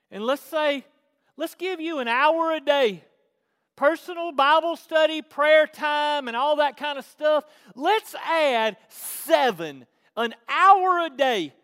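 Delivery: high, loud, emphatic preaching. Real, not acted. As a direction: hammer out each item for the congregation. Preaches at full force.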